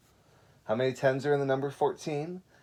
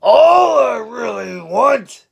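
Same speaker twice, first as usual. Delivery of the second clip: very loud, slow, higher bear shout; loud slow cranky bear; roaring bear; 12 semitones higher, 16 dB louder, much slower than usual. Acted. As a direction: bellow at the sheep as a grumpy bear. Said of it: "he turned and roared at Sheep".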